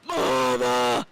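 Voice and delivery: low, loud silly cry of mama; goofy yell. Pretend. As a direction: bellow it in a put-on voice. Streamer yells in goofy voice.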